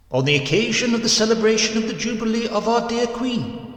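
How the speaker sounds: high, solemn churchy intoning; pulpit announcement. A narrator's reading. Parson announcing the Jubilee to the congregation, high and solemn.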